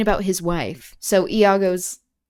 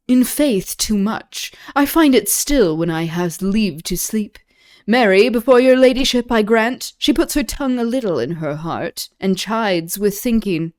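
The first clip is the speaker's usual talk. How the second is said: louder, higher Shakespeare verse read aloud; dramatic reading; 3 semitones higher, 6 dB louder than usual. Acted. Welcoming Desdemona ashore, read in a louder, higher dramatic voice. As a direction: declaim the greeting to the lady. Reads Iago's lines.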